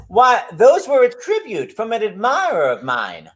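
slow, drawn-out Southern belle as Blanche; theatrical Southern drawl, stretching the words; Southern accent. Acted. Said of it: "I'm doing a Southern accent".

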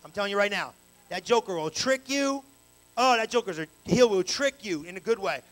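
ordinary delivery

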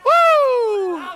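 long, high, loud "woo"; loud whoop. Real, not acted. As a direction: whoop in praise. Celebrating after shouted phrase.

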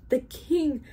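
high, urgent, mildly breathless reply; panted. A narrator's reading. Bartholomew answers the trumpeter, panting. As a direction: say it as if winded. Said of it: "panted Bartholomew".

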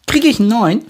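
put-on high, loud anxious child question; worried. A narrator's reading. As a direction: ask it fretting like a little girl. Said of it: "fragt Pepper besorgt".

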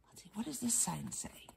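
unvoiced, quick whisper. Reader whispering to her young audience, hushed. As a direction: lean in and whisper.

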